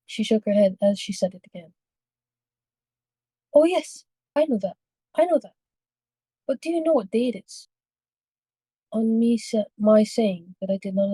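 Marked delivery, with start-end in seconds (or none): 3.5-7.7 put-on high, pressing old lady voice; insistent old woman. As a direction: urge him in a higher, worried tone. Old lady presses him again.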